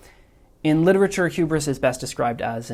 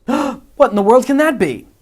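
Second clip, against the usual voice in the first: much higher, much louder mock astonishment; fake surprise; 12 semitones higher, 10 dB louder than usual. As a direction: exclaim as if you spotted something amazing. Vizzini fakes a distraction.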